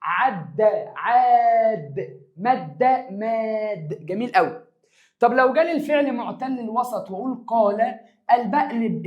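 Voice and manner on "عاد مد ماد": عاد and ماد with long drawn-out alif; stretched vowels. Shown as a demonstration. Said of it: "اسم الفعل أقول".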